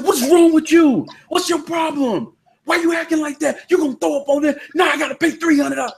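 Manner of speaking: high-pitched mock yell at his wife; snapping at him. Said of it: "He's snapping on him".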